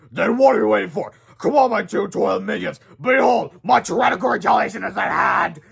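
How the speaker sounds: rally your minions grandly; boasting robot villain. Acted.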